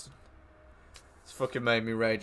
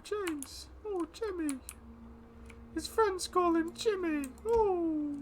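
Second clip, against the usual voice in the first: much higher, slow, sing-song mocking coo; silly high voice; 5 dB quieter, slower than usual. Gamer teasing friend about playing with James, high mocking coo. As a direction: coo it like a tease winding up a mate.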